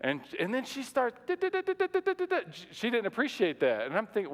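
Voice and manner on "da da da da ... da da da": high, rapid mock chatter of nagging syllables; mock chirping. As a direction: chatter like an annoyed bird, pitched way up. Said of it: "kinda chirping at me".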